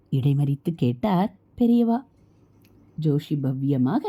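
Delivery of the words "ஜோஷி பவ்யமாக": usual speaking voice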